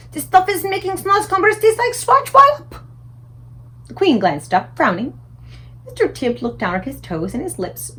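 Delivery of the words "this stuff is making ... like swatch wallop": much higher, loud giant rave; the giant raving about his food; delighted giant voice. A narrator's reading. The BFG praising the breakfast to the Queen, high and loud.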